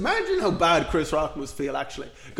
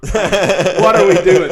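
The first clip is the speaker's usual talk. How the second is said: mock incredulous; 3 semitones higher, 11 dB louder, much slower than usual. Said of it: "Chris was like".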